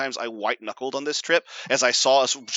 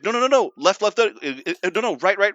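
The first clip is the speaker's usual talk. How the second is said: raised, rapid-repeat steering warnings; mock urgent directions; 6 semitones higher, 4 dB louder than usual. Son steering his drifting dad, mock frantic. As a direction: imitate a panicking passenger calling directions.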